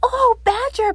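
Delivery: a frightened rabbit running for help; panting Rabbit. Acted. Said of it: "panted Rabbit".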